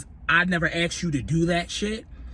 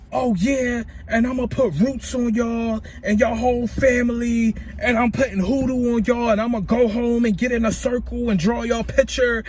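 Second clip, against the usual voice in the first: going in on them; 6 semitones higher than usual. Retold or imitated. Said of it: "I'm going in".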